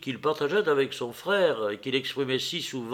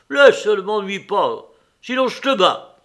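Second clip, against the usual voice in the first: loud, raised, threatening; angry cry; 6 semitones higher, 9 dB louder than usual. A narrator's reading. Nicolas shouts at Maria over the brandy, angrily. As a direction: cry out a threat to be left alone. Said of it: "cria-t-il".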